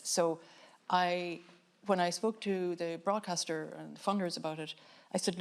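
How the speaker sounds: usual speaking voice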